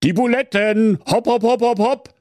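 barking orders. Acted. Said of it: "herrschte der böse Wolf den Bären an".

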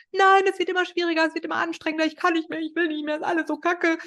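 high, hurried mock complaint; whining protest. Learner protesting to the boss who pushes on, whining.

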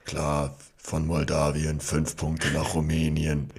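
low, slow Eurovision commentator monotone; flat, slow scoring announcement drone; Peter Urban monotone. Retold or imitated. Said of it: "er hat so eine sehr monotone Stimme".

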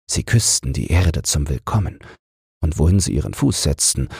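ordinary delivery, nothing marked

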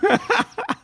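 lautes, hohes Auflachen; hohes Lachen. Real, not acted. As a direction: lach hell los. Laughs at the Buschmann sign-off. (hohes Auflachen)

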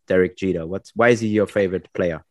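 usual speaking voice

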